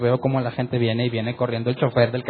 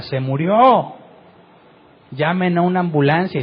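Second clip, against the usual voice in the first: grito de alarma imitado, algo más agudo; 3 semitones higher, 3 dB louder than usual. Retold or imitated. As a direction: imita a alguien pidiendo ayuda a gritos, sin gritar del todo. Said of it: "empezó a gritar". (grito de auxilio a media voz)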